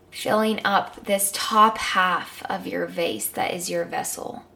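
calm guided meditation. Acted.

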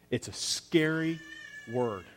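ordinary talk

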